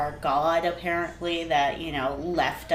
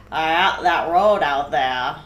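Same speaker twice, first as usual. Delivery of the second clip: put-on old Maine accent; 4 dB louder than usual. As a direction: tell it like an old Mainer warning about the road. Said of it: "what old Maine people sound like".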